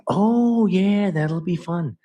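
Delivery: a high gasp, then slow, thrilled delight; giddy to be invited over. Retold or imitated. Invited to [name]'s house, high and thrilled.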